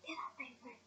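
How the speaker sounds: a soft, menacing order; Voldemort quietly ordering the tortured follower up; softly. A narrator's reading. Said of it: "said Voldemort softly".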